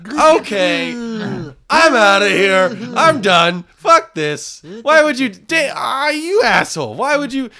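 storming off in a rage; angry outburst. Retold or imitated. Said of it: "And I just go".

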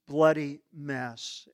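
ordinary talk, nothing marked

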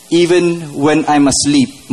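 ordinary talk, nothing marked

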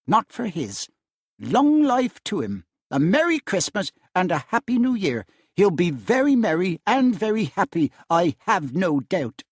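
wife giving a bitterly sarcastic toast to Scrooge. A narrator's reading. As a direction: toast him through clenched sarcasm. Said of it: "said Missus Cratchit".

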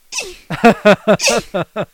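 imitated tiny high-pitched squeaky grunts; squeaky mouse grunt. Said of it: "he sounded like a little mouse".

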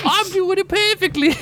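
high, squeaky mock Aussie; Australian accent. Podcaster defending his impression to co-host, mock Australian accent. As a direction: protest in a badly faked Australian accent. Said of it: "second stab at that Australian accent".

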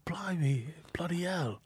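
hushed, awestruck mock Brit; whispered British exclamation. Acted astonished British onlooker.

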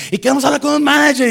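high, loud mob demand; angry passengers shouting. Acted. Angry passengers demanding the manager, shouting. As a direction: imitate an irate crowd yelling. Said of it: "la gente gritando, enojada".

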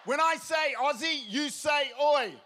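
loud, high crowd instructions; istruzioni gridate. Real, not acted. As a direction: shout the rules to a whole crowd.

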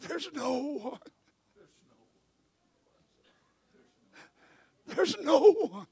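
slow, raspy sung close; hoarse preacher, drawn out slowly. Real, not acted. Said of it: "with my raspy voice to say it like this".

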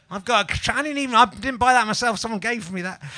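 usual speaking voice

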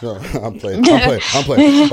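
laughing, I'm playing, rising high. Real, not acted. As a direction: laugh it off, playfully. Joking, laughing it off.

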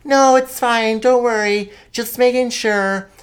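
high sweet reassuring mom impression; imitating mom. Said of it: "And then she's like".

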